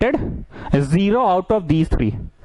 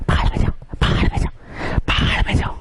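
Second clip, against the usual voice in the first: hushed, voiceless order to get out; whispered dismissal. Acted. Teacher telling the three students to go, in a whisper. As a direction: whisper it as an echoing send-off. Mock dismissal, unvoiced repeats.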